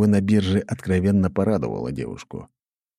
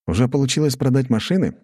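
surprised; 7 semitones higher than usual. A narrator's reading. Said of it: "удивлённо поднял брови я".

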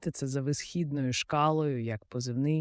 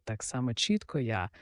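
usual speaking voice

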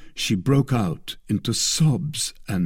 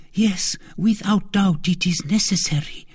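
anxious cry; 10 semitones higher than usual. A narrator's reading. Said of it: "cried the queen".

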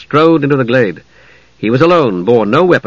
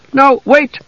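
high-pitched urgent cry; alarmed; 13 semitones higher than usual. Acted. Mage tries to hold back the knight, alarmed. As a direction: call out to stop him.

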